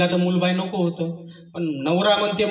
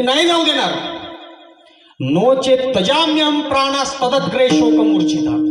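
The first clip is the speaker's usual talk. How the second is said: high desperate refusal; 8 semitones higher, 6 dB louder than usual. Acted. Brahmin refuses to let the sage leave, high desperate cry.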